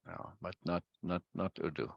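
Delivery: ordinary delivery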